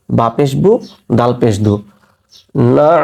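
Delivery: ordinary delivery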